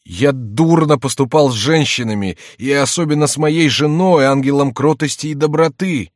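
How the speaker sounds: high, loud rueful confession; jester posing as penitent. A narrator's reading. Jester confesses wronging the wife, loud mock penitence. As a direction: lament with theatrical remorse.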